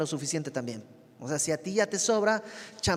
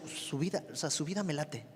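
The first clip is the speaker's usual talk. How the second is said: softer, admiring murmur; half-voiced impressed remark; admiring aside; 10 dB quieter than usual. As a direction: quietly say you like how they live. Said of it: "Todos conocemos a alguien que decimos".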